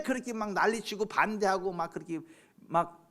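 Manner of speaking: loud, high-pitched indignant questioning; impassioned preaching. Real, not acted. Preacher protests uproar over church building, loud indignant preaching. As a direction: fire exasperated rhetorical questions at the congregation.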